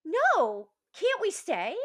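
put-on high, loud pleading little girl; pleading child. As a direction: beg to stay like a small kid. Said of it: "And she goes".

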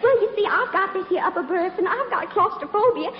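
high, gushing Southern plea; Southern belle routine. Pretend. Actress begs stranger for his room, high mock-pitiful Southern accent. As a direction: build to a breathless, high-pitched Southern sob story. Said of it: "my little old southern routine".

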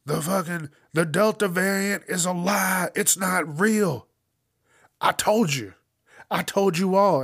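hammed-up fire-and-brimstone rant; mock ranting preacher. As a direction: shout it from the pulpit, indignant. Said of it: "he's like".

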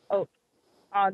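ordinary talk

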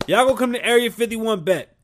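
high, loud mocking challenge; taunting voice. The aliens taunting the people, loud and high. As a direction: taunt them like a cocky rival. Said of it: "They said".

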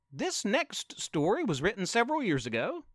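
ordinary delivery

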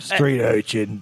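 put-on slow, creepy English accent; drawn-out mock English accent; old English accent. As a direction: sneer it like a caricature old English local. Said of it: "They do the accent".

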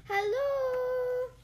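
long, high, loud call; drawn-out hallooing shout. A narrator's reading. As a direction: holler a greeting across the distance. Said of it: "Pooh shouted".

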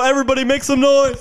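loud, high crowd hype call; hype man shout. Pretend. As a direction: pump up the crowd like a host. Hyping a pretend live crowd.

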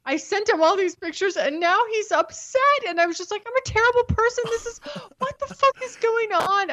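high, frantic, guilt-ridden rush; flustered panic. Retold or imitated. Herself telling her date about the boyfriend's messages, panicked. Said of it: "I'd get out of there like".

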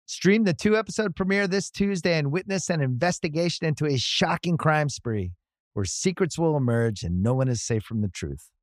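usual speaking voice

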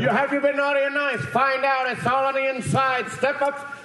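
high, insistent barker patter; carnival barker. Retold or imitated. Elf urging shoppers inside to see Santa, carnival barker voice. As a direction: sing out the sideshow pitch. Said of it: "like, a carnival Barker".

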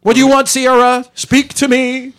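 booming high call to the spirit; loud summoning call. Acted. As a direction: call out to a ghost dramatically. Calling out to spirit.

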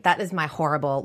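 normal talking voice

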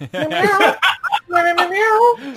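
high loud cat meows; yelled meows. Acted. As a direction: yell meow like a cat. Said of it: "can I yell".

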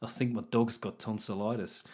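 low, soft soap-opera gravity; mock serious. Neighbours character in a serious moment, mock grave. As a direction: deliver it as grave soap drama, understated. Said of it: "they'd have really serious moments".

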